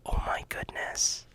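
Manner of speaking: whispered in awe, "Oh my goodness" barely breathed; awed whisper. Real, not acted. [name] reacting to the Quran for kids idea, awed whisper. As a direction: breathe it out in wonder. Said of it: "Just hearing it made me excited".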